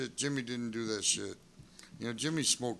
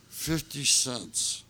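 "fifty cents" drawn out word by word; emphatic slow stress; much slower than usual. Real, not acted. Friend telling of the tiny liquor tax, slow emphasis on "fifty cents". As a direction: stretch "fifty cents" slowly to show how trivial it is.

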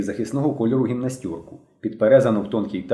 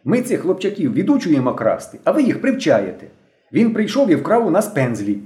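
higher, emphatic reproach; severe, raised-pitch accusation; stern scolding; 7 semitones higher, 4 dB louder than usual. A narrator's reading. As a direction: scold them like a strict youth leader. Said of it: "суворо сказала дівчина".

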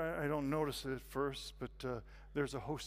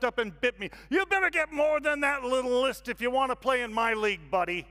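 loud, high shout from the back; yelling heckler; 9 semitones higher, 11 dB louder than usual. Acted. Man at the back outdoes newcomer's confession, yelling. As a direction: bellow a one-upping challenge across a room. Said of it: "shouting at me from the very back".

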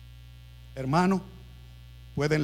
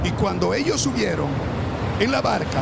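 preaching at full force; 4 semitones higher than usual. Acted. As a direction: preach the storm story to the congregation. Preached narration.

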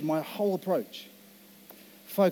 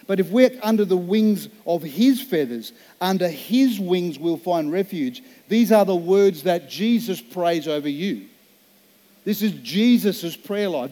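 slow, louder preaching voice; 2 semitones higher, 5 dB louder than usual. Acted. Pastor to congregation, preaching the promise slowly and strongly. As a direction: proclaim it over the church, slow and full.

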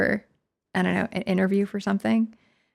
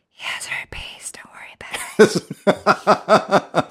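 slow conspiratorial whisper; mock gossip whisper; much slower than usual. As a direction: lean in and murmur a secret. Leaning over whispering to fan.

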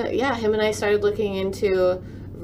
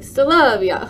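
"Still love ya" said as a louder, higher, laughing imitation of a Polish "Nostrovia"; mock Polish toast; 5 semitones higher, 6 dB louder, slower than usual. Teasing grandpa's toast, laughing mock Polish accent. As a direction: tease grandpa with his toast pronunciation. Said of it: "we'd always be like".

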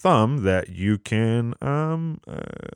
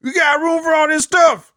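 high, louder cheery camper; high mock voice; 4 dB louder than usual. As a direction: call out brightly, way up high. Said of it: "It's like".

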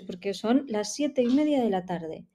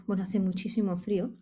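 ordinary talk

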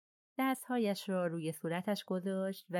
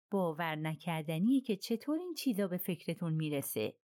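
ordinary delivery, nothing marked

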